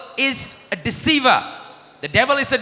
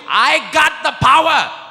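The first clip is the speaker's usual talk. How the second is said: slow, higher, louder boast; boastful claim; 5 semitones higher, 3 dB louder, slower than usual. Retold or imitated. Preacher mocks someone claiming power, slow boast. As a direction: drawl the brag out proudly. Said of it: "If anybody says to you".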